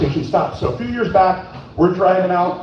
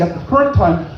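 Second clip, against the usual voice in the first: ordinary talk, nothing marked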